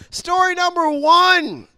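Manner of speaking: high, slow showman call; announcer. Acted. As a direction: announce it like a game show host. Host announces story.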